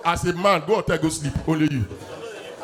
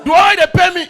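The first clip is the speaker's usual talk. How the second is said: indignant shout; 9 semitones higher, 10 dB louder than usual. Real, not acted. Comedian's outburst.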